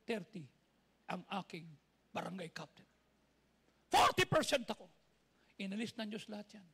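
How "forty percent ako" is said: loud high preacher's exhortation; preaching at full force. Acted. Pastor urging the congregation, loud fervent preaching. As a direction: preach it out to the crowd with fire.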